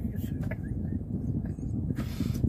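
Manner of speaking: breathy, high wheezing laugh; real laughter. Real, not acted. Preacher amused by own talk with the Lord, laughing. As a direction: laugh helplessly at your own silliness. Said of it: "I'm laughing".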